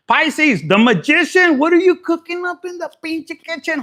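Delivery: high, loud hype call; excited call-out. Real, not acted. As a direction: shout it out to the sign. Calling out to Pisces.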